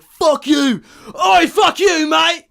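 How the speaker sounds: high, loud yell of abuse; screaming reenactment. Retold or imitated. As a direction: yell it out like screaming at another driver. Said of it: "screaming at the top of my lungs".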